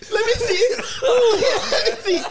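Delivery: laughing. Real, not acted. Laughing hard after the worm.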